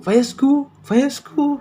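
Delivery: high, loud mocking echo of "Fire school?"; mocking friends. Friends laughing at his slip, loud high mocking repeat. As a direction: throw the mistake back at him, teasing. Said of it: "And then they were like".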